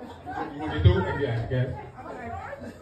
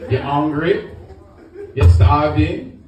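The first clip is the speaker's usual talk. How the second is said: lightly voiced Caribbean father scolding; a hint of Caribbean accent, nagging son's friend; Caribbean dad; 5 semitones lower than usual. Caribbean dad nagging his son's friend in the kitchen, lightly accented. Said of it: "I ain't never heard it done in Caribbean terms".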